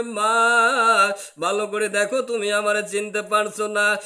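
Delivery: high, loud wailing plea to mother; tearful shouting plea. Retold or imitated. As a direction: beg her loudly, half wailing. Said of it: "দু চোখের পানি ফলাইয়া দিয়ে চিল্লা পাল্লা করবে".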